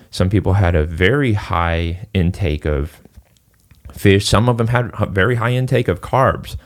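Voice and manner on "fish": normal talking voice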